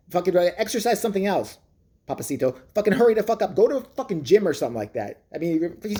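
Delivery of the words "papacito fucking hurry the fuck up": ordinary delivery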